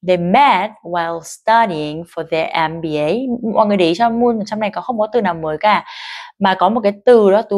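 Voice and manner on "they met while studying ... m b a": slow, stressing "met", "while", "studying", "M B A"; stress met while studying MBA. Shown as a demonstration. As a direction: copy the rhythm beat by beat. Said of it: "bắt chước được cái nhịp của người ta". (nhấn vào met, while, studying)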